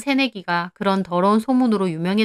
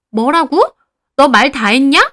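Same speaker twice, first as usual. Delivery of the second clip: loud, high outraged challenge; angry quarrel; 6 semitones higher, 9 dB louder than usual. A narrator's reading. Heeju reacts to her lover's insults, outraged shout. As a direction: snap back, daring him to go on. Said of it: "둘이 대놓고 욕하며 싸우더라고요".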